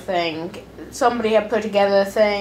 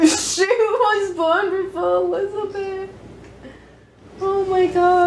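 laughing; 11 semitones higher, slower than usual. Real, not acted.

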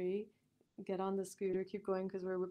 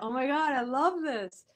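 excited; 5 semitones higher, 10 dB louder than usual. Retold or imitated. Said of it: "it was like that".

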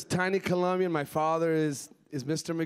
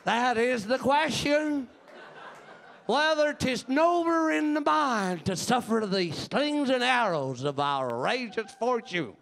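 put-on country-accent Hamlet; 4 semitones higher, slower than usual. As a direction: recite Shakespeare in a broad country accent. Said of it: "like, in a country accent. You can't hear Hamlet go".

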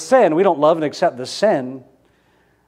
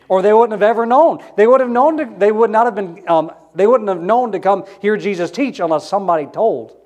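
fervent preaching; 5 semitones higher, 4 dB louder than usual. Real, not acted. Preaching with rising force.

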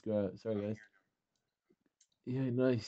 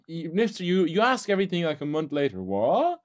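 high, loud telling-off; exasperated scolding; 6 semitones higher, 4 dB louder than usual. Real, not acted. Clan leader scolding a player who asks late, exasperated. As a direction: scold with real exasperation, rising at the end.

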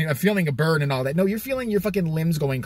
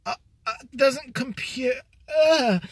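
mock robot glitch; 9 semitones higher, slower than usual. Mocking clerk's confusion.

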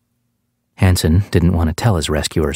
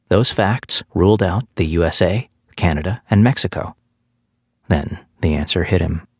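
usual speaking voice

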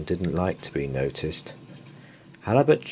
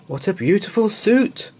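high, louder gushing praise; admiring crowd; 6 dB louder than usual. Acted. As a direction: gush over the suit.

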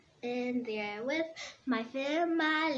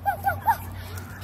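high yappy woofs like a little dog; dog barking. Pretend. Child singing about her dog, barking high like a puppy.

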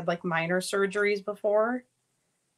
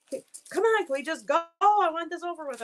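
put-on impatient kid; 7 semitones higher than usual. Son wants surgery over, mock impatient kid voice. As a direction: hurry them along like a bored child. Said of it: "He was just like".

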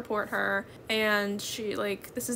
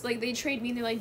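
ordinary talk, nothing marked

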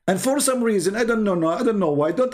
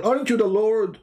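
ordinary talk, nothing marked

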